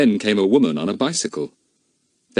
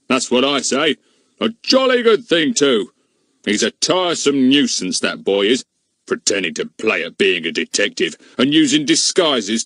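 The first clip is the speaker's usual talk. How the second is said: gloating policeman; 4 semitones higher, 3 dB louder than usual. A narrator's reading. Goon gloats at length.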